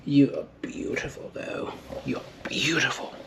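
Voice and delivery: soft, airy whispered compliment; playful hushed cooing in a whisper; admiring whisper. Pretend. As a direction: whisper sweet flattery to a plant. Playfully whispering compliments to new plant.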